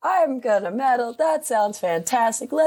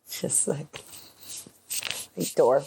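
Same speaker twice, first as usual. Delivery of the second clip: ordinary talk, nothing marked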